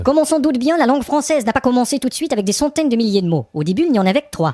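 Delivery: documentary narration. A narrator's reading. Narrator opening the history of French, loud and grand.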